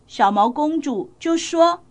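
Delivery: usual speaking voice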